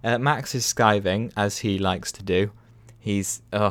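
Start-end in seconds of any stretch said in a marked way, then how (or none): none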